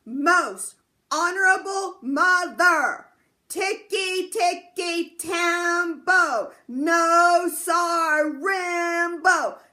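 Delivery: drawn-out, loud, sing-song reverent slow name; very slowly. Acted. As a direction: stretch every syllable, high and loud. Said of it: "very, very slowly he said".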